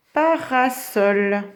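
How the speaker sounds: parasol slowly as pa-ra-sol; syllables marked. Shown as a demonstration. Teacher giving pupils the word, parasol syllable by syllable. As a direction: space out each syllable of "parasol". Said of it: "combien il y a de syllabes?".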